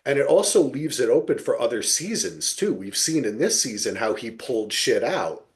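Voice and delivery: ordinary talk, nothing marked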